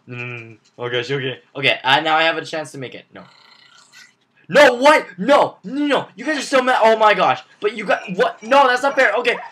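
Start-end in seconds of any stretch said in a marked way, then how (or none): 4.5-9.4 frustrated yelling. Real, not acted. Frustrated loss in game.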